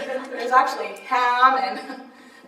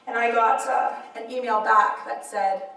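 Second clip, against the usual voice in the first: ordinary talk, nothing marked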